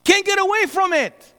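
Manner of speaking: normal talking voice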